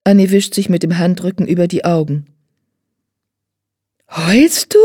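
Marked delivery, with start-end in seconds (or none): 4.1-4.8 put-on higher, surprised girl's question; a girl amazed to see tears; high astonished child asking. A narrator's reading. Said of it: "fragt Pia erstaunt".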